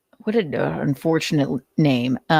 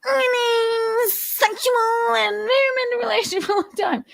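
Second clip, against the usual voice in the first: very high, crying mock voice; Nick impression. Narrator repeating Nick's line in a high mock wail. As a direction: wail it out in a squeaky whimper. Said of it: "Nick's impression".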